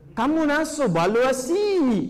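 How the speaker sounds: put-on slow, drawn-out Bangladeshi accent. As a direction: stretch it out like a Bangladeshi speaker. (logat Bangladesh, lambat)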